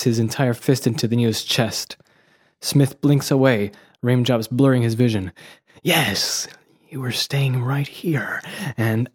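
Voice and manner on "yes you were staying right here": slow, breathy near-whisper; hushed villain. Acted. Smith gloats over fallen Neo, hushed and slow. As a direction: savour it in a slow hush. Reading Smith's line from script.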